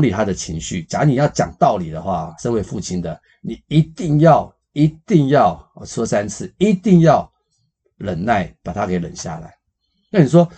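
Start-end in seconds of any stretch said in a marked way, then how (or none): 3.4-7.3 一定要 said three times, much higher and louder each time; stress on 一定要. Shown as a demonstration. Teacher drilling fathers: 一定要, pushed high and loud. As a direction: hammer 一定要 home, rising and louder.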